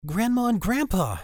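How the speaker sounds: put-on high, bright young girl; cheery girl voice. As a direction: call out happily to them. Ellie greets grandparents brightly.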